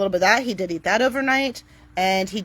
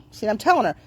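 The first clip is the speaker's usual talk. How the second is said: normal talking voice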